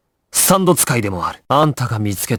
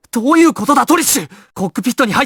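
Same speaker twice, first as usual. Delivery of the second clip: alarmed shout; 12 semitones higher, faster than usual. Acted. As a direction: call out in alarm. Alarmed demand to Trish. (驚いた叫び)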